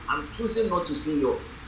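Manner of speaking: normal talking voice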